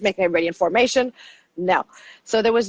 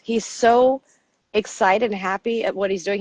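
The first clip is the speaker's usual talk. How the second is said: usual speaking voice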